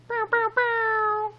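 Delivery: high, slow mouth air horn blast; "Bow, bow, bow" as a silly air horn; air horn noise. Pretend. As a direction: imitate an air horn to hype the answer. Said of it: "Need a little air horn".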